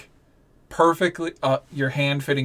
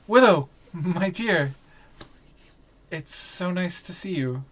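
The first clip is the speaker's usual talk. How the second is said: slightly raised, tender greeting; lightly voiced reunited lover; 5 semitones higher than usual. Game master as off-brand Jacob greeting Willow, lightly voiced tenderness. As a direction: greet a lost beloved in a lightly acted voice. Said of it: "and he goes".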